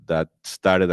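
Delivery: ordinary delivery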